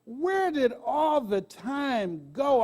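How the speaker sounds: slow, drawn-out wondering; wistful, thoughtful question; reflective. Real, not acted. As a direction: let it hang as you ask yourself. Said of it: "that's what I asked myself".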